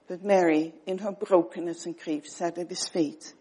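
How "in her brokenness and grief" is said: ordinary talk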